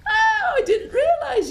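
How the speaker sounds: high laughing witch voice; witch delighted. Acted. Witch surprised by creatures, high and laughing. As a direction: giggle it like a delighted old witch. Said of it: "She says".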